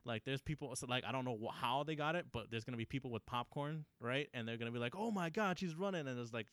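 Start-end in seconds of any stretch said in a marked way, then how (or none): 5.0-6.1 higher-pitched excited exclamation; mock excited onlooker. Moviegoer watching the girl run to her dad, mock excited. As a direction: gush like a thrilled spectator.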